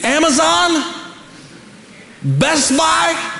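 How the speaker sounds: high-pitched, incredulous marvelling; mock amazement. Preacher revealing you can get it at Walmart, mock amazement. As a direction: marvel at it as if revolutionary. Mock revelation of where Bibles sell.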